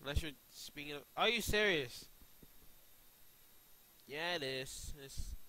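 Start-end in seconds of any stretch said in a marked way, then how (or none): 1.1-2.0 raised, louder incredulous question; high, loud incredulous outburst; loud disbelief. Real, not acted. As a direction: throw it back in disbelief. Argues back in disbelief.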